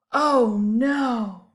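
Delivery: loud dismayed oh no; alarmed oh no. Acted. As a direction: cry out in dismay. Cake ruined.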